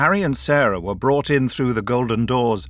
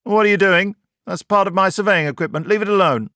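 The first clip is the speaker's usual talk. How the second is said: higher, louder, testy protest; irritable snap; 9 semitones higher, 5 dB louder than usual. A narrator's reading. Kelman objects to the doctor fiddling with his equipment, irritable snap. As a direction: snap irritably at someone meddling. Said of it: "Irritably, Kelman snapped".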